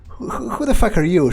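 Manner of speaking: ordinary delivery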